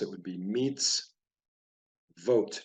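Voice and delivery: "meets vote" with a clear break between syllables; broken into syllables. Shown as a demonstration. Teacher splitting a Hebrew word for learners, broken into syllables. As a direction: say "meets", pause, then "vote" as two separate syllables. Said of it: "broke this word into syllables".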